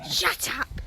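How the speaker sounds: ordinary talk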